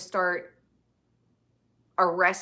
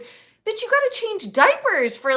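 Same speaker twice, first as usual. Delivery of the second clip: much higher sassy call-out; 11 semitones higher, 4 dB louder than usual. Real, not acted. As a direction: set her straight with attitude. Scolding address, pitch jump.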